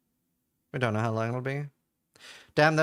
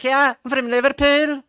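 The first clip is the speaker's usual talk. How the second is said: put-on much higher, louder Liverpool accent; higher Scouse accent; 10 semitones higher, 8 dB louder than usual. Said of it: "Liverpool is always a little higher".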